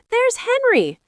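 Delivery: put-on high, eager girl's call; excited girl. A narrator's reading. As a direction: point him out with delight. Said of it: "cried Fern".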